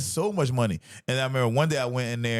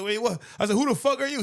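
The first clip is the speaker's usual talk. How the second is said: shocked disbelief; 11 semitones higher than usual. Retold or imitated. Confronted by undercover security guard.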